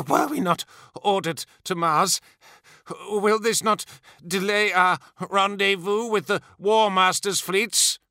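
choked, high, halting speech; breath-starved, timid questioning; between snatched breaths. A narrator's reading. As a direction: gasp it out while held by the throat. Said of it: "Between snatched breaths".

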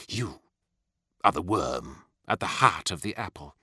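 low, menacing accusation; quiet threat. A narrator's reading. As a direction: drop low and pronounce judgement on a traitor. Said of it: "sensed the awful threat in Fulgrim's words".

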